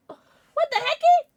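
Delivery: high, loud, slow squeak of disbelief; shocked squeal. Real, not acted. Shocked at donation.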